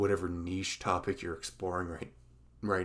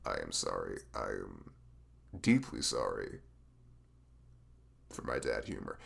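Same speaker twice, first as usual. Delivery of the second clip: mock solemn apology; 11 semitones lower than usual.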